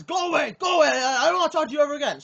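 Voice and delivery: high, loud, frantic mock shouting; yelling old man. Old man refusing player's apology, imitated angry yell. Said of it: "the old man says".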